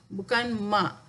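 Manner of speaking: ordinary talk, nothing marked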